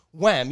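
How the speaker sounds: loud, sharp "Wham" hit sound; mock punch sound. Describing knocking out the slap boxer, loud mock punch. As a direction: slam it like a punch landing. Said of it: "I really don't wanna be like".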